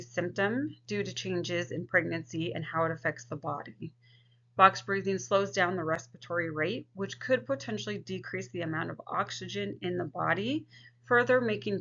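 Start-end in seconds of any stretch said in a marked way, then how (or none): none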